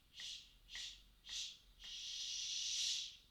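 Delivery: unvoiced shh pulses swelling at the end; shh crescendo. Shown as a demonstration. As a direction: pulse the shh and grow the last one. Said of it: "let's do that together".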